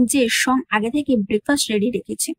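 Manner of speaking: usual speaking voice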